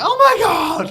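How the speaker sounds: very high, loud exclamation; a sudden thrilled outburst; excited shriek. Retold or imitated. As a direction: scream it at the screen. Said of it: "I was like".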